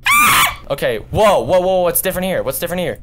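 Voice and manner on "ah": mock scream. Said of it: "Y'all are probably watching this video like".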